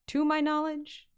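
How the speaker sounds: a grand, drawn-out mock-serious aside; mock-pompous emphasis. Real, not acted. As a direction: puff it up like a know-it-all. Mock-serious aside about the lore.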